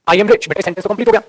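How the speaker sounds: normal talking voice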